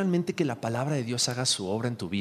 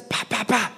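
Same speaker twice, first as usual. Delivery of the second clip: quick higher-pitched "pa" smacks; imitated spanking sounds; 6 semitones higher, slower than usual. Father describing his baby's light spanking, imitated smacks. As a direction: voice the little smacks one by one.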